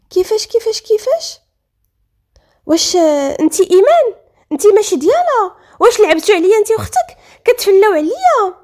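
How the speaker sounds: high-pitched, stunned disbelief turning loud; shocked. A narrator's reading. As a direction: fire off questions in rising outrage. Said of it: "سمير شاف فيها بصدمه".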